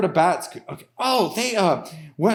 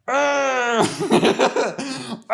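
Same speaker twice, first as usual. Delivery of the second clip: farmer growl; 6 semitones higher than usual. Acted.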